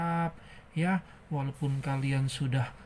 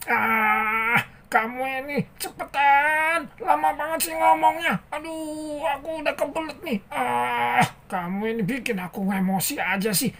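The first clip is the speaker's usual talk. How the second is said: very high, hammed-up tantrum; exasperated high-pitched puppet rant; angry impatient character; 11 semitones higher, 5 dB louder than usual. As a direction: whine and fume like a cartoon character. Angry character interrupts again.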